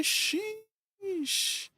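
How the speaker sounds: very high, drawn-out amazed sheesh. Real, not acted. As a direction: let it rise way up in disbelief. Friend one-taps a rare ring.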